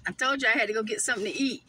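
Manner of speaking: ordinary talk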